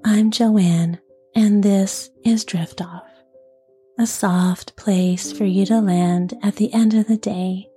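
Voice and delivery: soft, gentle whisper; soft bedside voice. Real, not acted. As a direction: whisper it gently, like tucking someone in. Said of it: "with a nice, soft, gentle voice".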